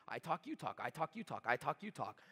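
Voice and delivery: soft, even back-and-forth beat; rhythmic demo. Shown as a demonstration. Teaching sales reps the rhythm, soft and steady. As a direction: tap out the rhythm softly, I talk then you talk. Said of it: "a process of engagement".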